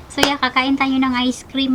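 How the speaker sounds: normal talking voice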